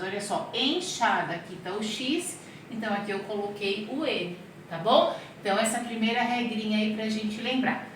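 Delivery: ordinary talk, nothing marked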